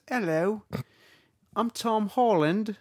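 high, slow fake Cockney; Tom Holland. British actor greeting trailer viewers, bad Cockney. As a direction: introduce yourself in badly faked British. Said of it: "He goes".

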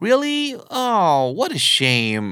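high surprised "Really?", then a drawn-out regretful sigh of words; acted, honest letdown, slow on "what a shame"; sincere disappointment. Shown as a demonstration. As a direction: sound sorry to hear the plan is off. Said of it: "โอ้ น่าเสียดายจังเลยเนาะ".

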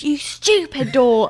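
put-on childish yell at the door; mock scream. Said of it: "I screamed".

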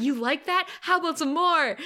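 mock tough challenge; Cordelia, taunting. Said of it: "And she's like".

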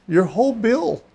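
high-pitched disbelief; counselor's stunned repeat. Retold or imitated. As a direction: repeat the big news in wonder. Counselor repeats the astonishing news.

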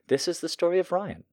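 said high through a laugh; breaking into laughter. Real, not acted. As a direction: giggle while restarting the line. Restarts the line, laughing.

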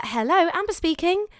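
bright high phone greeting. Shown as a demonstration. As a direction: answer like a polite receptionist. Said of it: "I'd say it was my phone voice".

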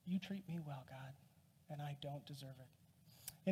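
soft, low-voiced prayer with reflective pauses. Preacher thanking God for difficult people, hushed prayer. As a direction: speak quietly to God, leaving space between phrases.

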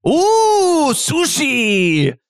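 high excited ooh sushi; put-on chef voice. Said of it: "What was that voice that you put on".

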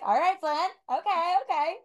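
put-on lightly drawled Southern accent; a hint of a Southern twang. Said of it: "That's that Southern drawl coming out".

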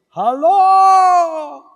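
high, loud call across a canyon. Retold or imitated. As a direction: shout "Aló" into the canyon. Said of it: "y yo digo". (grito alto y fuerte hacia el cañón)

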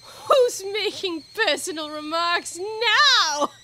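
high, loud, drawn-out gloating; triumphant. A narrator's reading. Hatter catches out Alice, triumphantly. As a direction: crow it like a winner. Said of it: "the Hatter asked triumphantly".

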